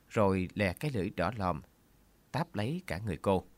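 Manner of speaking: usual speaking voice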